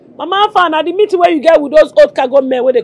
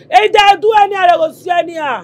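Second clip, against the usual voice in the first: angry shouted threat; 3 dB louder than usual. Acted. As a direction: shout the threat, hard and loud.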